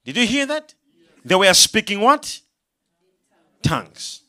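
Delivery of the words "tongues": usual speaking voice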